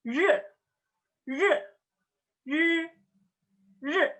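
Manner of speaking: R as a buzzed, curled-tongue "rr", not Indonesian er; Mandarin R. Shown as a demonstration. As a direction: buzz R with the tongue curled up, unrolled. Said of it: "dibacanya bukan R".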